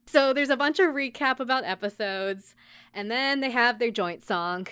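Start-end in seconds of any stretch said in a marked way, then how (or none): none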